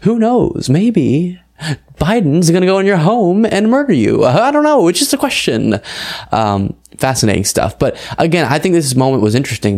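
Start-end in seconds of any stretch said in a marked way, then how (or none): 0.0-0.7 high mock shrug on knows; who knows drawn up high and innocent; sarcastic faux-innocent who knows; mocking Tucker Carlson. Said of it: "he asks questions". 0.7-5.8 much higher, sarcastic faux-innocence; mocking Tucker Carlson. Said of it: "he asks questions".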